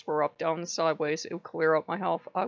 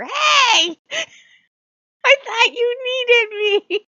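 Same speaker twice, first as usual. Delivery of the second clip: very high, loud, slow protest; a hurt god shouting back, voice way up; indignant Loki; 12 dB louder, much slower than usual. Retold or imitated. Loki protesting to owner in a high loud hurt cry. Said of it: "I just heard".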